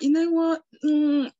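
very high, loud, fast squeak; awkward high squeak. Retold or imitated. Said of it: "literally that sound I was thinking of".

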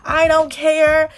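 I don't care sung out high and loud, slowly. Shown as a demonstration. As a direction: throw out I don't care with sassy defiance. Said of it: "I, I don't care".

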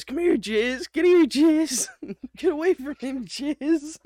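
high raspy calling voice; calling the dog in a rough voice; raspy woman voice. Retold or imitated. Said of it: "she says".

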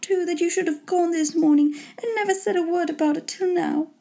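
high-pitched, gushing wife voice; high matronly rapture without volume; delighted mother. A narrator's reading. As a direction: gush as a gratified wife, pitched well up.

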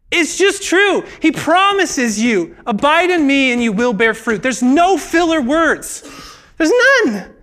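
fervent insistence. Real, not acted. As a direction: hammer home that it's true. Preacher's emphatic insistence.

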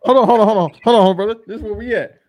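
loud, high urgent hold on; excited interruption. Real, not acted. Cutting off his cohost mid-argument, loud and worked up. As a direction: jump in hard to stop him.

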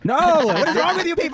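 loud, slow disbelieving cry; exasperated outburst. Real, not acted. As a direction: throw up your hands and yell it.

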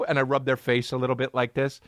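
ordinary talk

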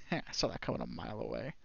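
breathy hushed raven, snickering; a sly whispery bird voice mocking the fall; whispered raven cackle. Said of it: "and he goes".

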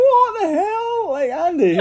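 very high, slow disbelief; incredulous. Retold or imitated. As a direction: stretch it out in shock. Disbelief at Andy's tweets.

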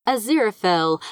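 ordinary delivery, nothing marked

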